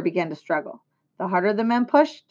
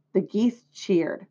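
usual speaking voice

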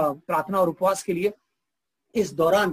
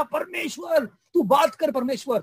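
shouting to God; 7 semitones higher than usual. Acted. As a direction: yell up at the sky, demanding an answer. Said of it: "चिल्लाया, बोला".

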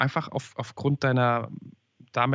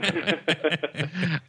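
higher-pitched burst of laughter; amused laugh; 4 semitones higher than usual. Real, not acted. Host reacting to guest defending the cat photo, amused laugh.

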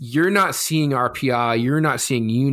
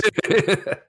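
sinister laugh; 8 semitones higher than usual. Real, not acted. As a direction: cackle like a villain. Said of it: "That was like a sinister laugh I just gave".

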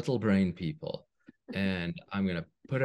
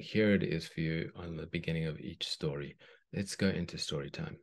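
put-on reading to children; 3 dB louder than usual. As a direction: read it like a bedtime story. Reads a children's story aloud.

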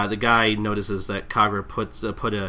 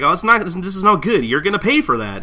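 complaining customer; 9 semitones higher, 7 dB louder than usual. Retold or imitated. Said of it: "He's like".

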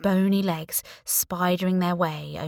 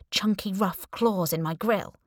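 plain reading voice

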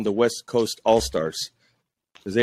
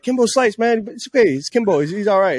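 reassuring cop; 9 semitones higher, 3 dB louder than usual. Retold or imitated. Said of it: "So I'm like".

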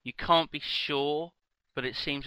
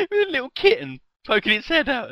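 excited squeal; 13 semitones higher, 8 dB louder, faster than usual. Real, not acted. GM still squealing with delight.

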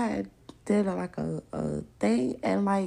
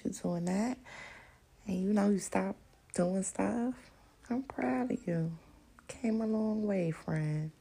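normal talking voice

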